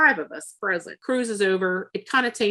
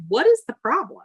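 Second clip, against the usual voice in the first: higher, louder retold challenge; raised voice, acting out impatience; exasperated demand; 7 semitones higher, 5 dB louder than usual. Butts into quarrel with the purser.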